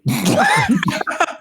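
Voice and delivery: squealing burst of laughter; real laugh; high laugh. Real, not acted. Laughing at the outcome.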